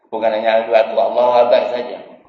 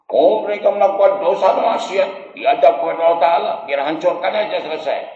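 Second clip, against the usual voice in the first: raised, slightly strained pleading voice; Ibrahim's reply; 6 semitones higher than usual. Acted. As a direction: plead your case to God, voice lifted high. Ibrahim answers God in story.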